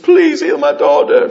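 high, loud begging shout; pleading cry. Acted. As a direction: beg loudly, acting out a desperate parent. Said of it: "People fall at his feet".

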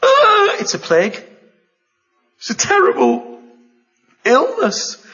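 high, loud cry of alarm; horrified cry. Acted. Preacher voicing Moses' alarm at leprosy, high and loud. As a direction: cry out at the diseased hand.

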